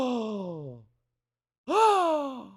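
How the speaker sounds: surprised gasp. Real, not acted. Sees her number posted.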